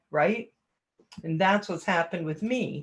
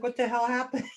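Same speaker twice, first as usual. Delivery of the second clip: high baffled "what the hell"; higher bewildered voice; 6 semitones higher, faster than usual. Retold or imitated. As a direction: sound totally lost mid-fall. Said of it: "I'm just like".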